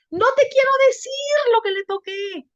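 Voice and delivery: very high, far-carrying mimicry of a girl; imitated giggly girl. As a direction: blurt it out in her high-pitched voice. Girl blurts secret despite shushing.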